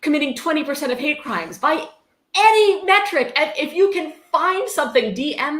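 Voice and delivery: high, loud, forceful insistence; emphatic rant. Real, not acted. Podcast host rebutting fake statistics, loud and outraged. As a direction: hammer each word home, outraged.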